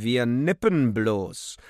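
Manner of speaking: loud, drawn-out boom. A narrator's reading. Worker in red smock answers the waiter, booming. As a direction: boom it out slowly. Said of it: "dröhnte".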